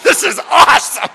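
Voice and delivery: high, loud shout of delight; over-the-top joyful yell; exultant shout. Acted. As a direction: burst out loudly, thrilled. Exults to grumbling coworker.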